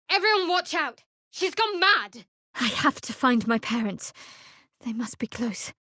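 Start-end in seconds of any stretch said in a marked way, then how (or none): 0.1-2.2 urgent warning shout. Acted. Companion warning the party of a madwoman, alarmed shout. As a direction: yell the warning to everyone nearby.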